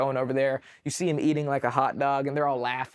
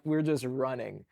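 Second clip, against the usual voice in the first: ordinary delivery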